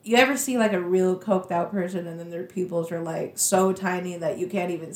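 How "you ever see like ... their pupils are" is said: ordinary talk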